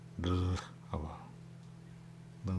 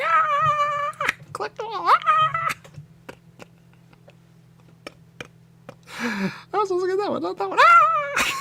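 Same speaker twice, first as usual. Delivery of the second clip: squealing excitement; 10 dB louder, slower than usual. Real, not acted.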